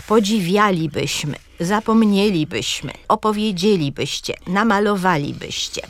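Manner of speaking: akcent na WIA, MNIE, DZIE, WA w „podziwialibyśmy, zapomnielibyśmy, opowiedzielibyście, namalowalibyście”; akcent na czwartą sylabę. Shown as a demonstration. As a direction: akcentuj „podziWIAlibyśmy, zapoMNIElibyśmy”, końcówki „libyśmy” słabe. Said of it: "na czwartej sylabie akcent". (wzorcowo „opowieDZIElibyście, namaloWAlibyście”, czwarta sylaba od końca mocna)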